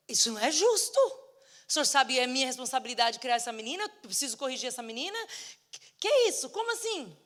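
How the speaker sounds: loud, high outraged complaint; acting out furious disbelief; irate protest. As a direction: argue back indignantly, voice climbing high. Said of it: "voltei pro meu quarto irada".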